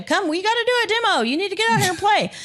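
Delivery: high-pitched, insistent rallying call; imitating Pop's urging. Pop dragging friends to a gym demo, imitated eager urging. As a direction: badger them to join in, as a quote.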